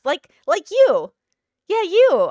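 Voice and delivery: loud, high, drawn-out mock taunt; single them out with exaggerated teasing; mocking jab. Acting Susan singling the listener out.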